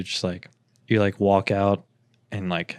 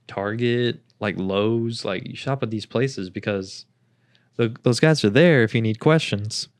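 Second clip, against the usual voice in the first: usual speaking voice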